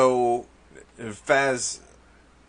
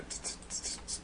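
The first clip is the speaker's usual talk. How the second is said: usual speaking voice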